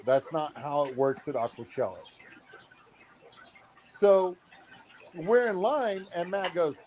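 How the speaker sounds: usual speaking voice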